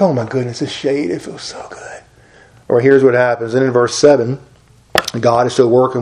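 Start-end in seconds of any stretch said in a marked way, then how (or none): 0.0-2.0 softer and softer, fading into a whisper; blissful sigh of relief. Retold or imitated. Preacher voicing Jonah enjoying the shade, fading to whisper. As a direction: melt into the shade, whispering by the end.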